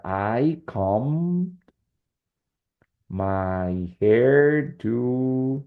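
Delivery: very slow, stretched-out words; dictating. Shown as a demonstration. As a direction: draw out each word as if writing it up. Teacher dictating example while writing it.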